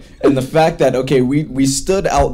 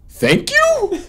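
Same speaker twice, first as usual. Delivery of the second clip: squeaky high baffled thank you. Retold or imitated. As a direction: squeak it out in confusion. Director baffled by the compliment.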